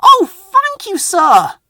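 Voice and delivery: high, loud delighted squeal; excited cry. Acted. Rheneas thrilled at the important job, cries out high. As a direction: burst out with sheer joy. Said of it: "he cried".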